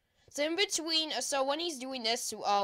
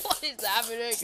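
ordinary talk, nothing marked

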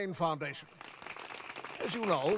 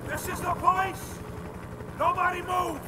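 high, loud commanding shout; raid shout; 10 semitones higher, 4 dB louder than usual. Acted. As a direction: storm in and take control. Thugs bursting into the room.

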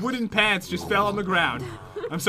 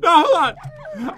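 laughing; 8 semitones higher, 6 dB louder, much slower than usual. Real, not acted. Still laugh-crying after joke.

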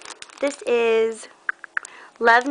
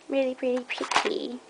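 put-on soft, slightly higher British accent; 3 semitones higher, 6 dB quieter than usual. Admiring craft haul items to viewers, playful British accent. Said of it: "I have a British accent today".

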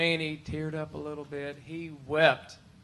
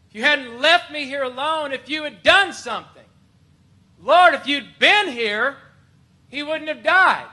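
anguished accusation; 10 semitones higher, 11 dB louder than usual. Acted. Preacher acts Martha confronting Jesus over her brother, anguished shouting. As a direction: shout it, much higher and louder. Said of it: "How do you think she said that".

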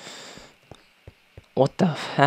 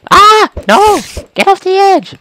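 high, loud screaming at the screen; loud frantic cry; panicked yell; 10 dB louder than usual. Real, not acted. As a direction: shriek for it to get off. Panicked yelling at game mob.

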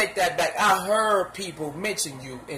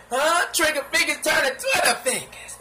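higher, laughing mockery; mocking, higher; 7 semitones higher than usual. Shown as a demonstration. Mocking the rapper's line to viewers, laughing and higher. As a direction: tease it with a laugh. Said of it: "repeats line louder".

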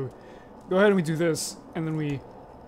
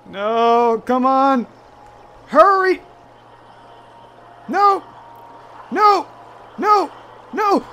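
frantic high yells of no; panicked shouting at the screen; panicking gamer; 7 semitones higher, 8 dB louder, much slower than usual. Real, not acted. Panicking at monster chasing character.

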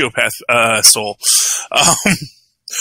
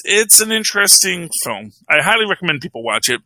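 normal talking voice

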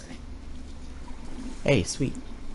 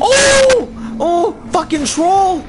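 high, loud cry of shock; startled yell; 13 semitones higher, 5 dB louder, much slower than usual. Real, not acted. Gamer jumped by a troll, high startled yelling. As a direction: yelp out, then curse loudly.

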